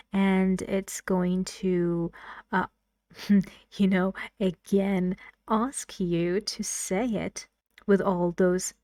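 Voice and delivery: slow, carefully spaced teacher instructions; walk learners through the exercise step by step; slow classroom English. Acted.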